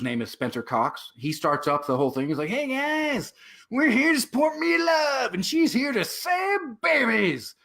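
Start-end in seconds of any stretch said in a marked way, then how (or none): 2.5-7.5 high-pitched mock rally shout; imitation of a cheering event host; hyped-up rally host. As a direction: call out like an overexcited warm-up host. Imitates governor hyping up the rally.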